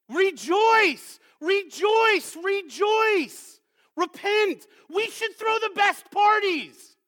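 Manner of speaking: high, loud preaching cry; exultant exhortation. Real, not acted. Preacher urging the congregation to rejoice and throw parties, exultant. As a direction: cry it out as a joyful command.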